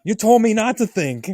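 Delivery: loud, high protest in a retold argument; hammed-up indignant retort; defensive mock yell. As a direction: shout back in self-defense, playing it up. Retold quarrel, defensive shout back.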